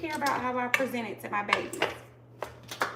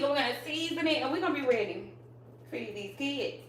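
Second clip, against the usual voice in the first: normal talking voice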